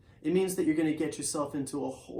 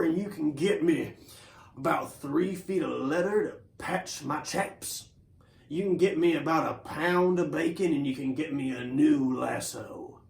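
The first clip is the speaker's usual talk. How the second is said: cowboy voice. Acted. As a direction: lay out the shopping list like a relaxed rancher. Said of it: "and he sounds like this".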